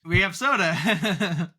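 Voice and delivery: high, drawn-out quote breaking into a laugh; playful drawl tipping into laughter; amused mock quote. Joining in a retold quote, amused.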